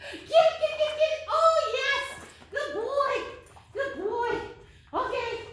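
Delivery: excited urging. Real, not acted. Urging dog to grab.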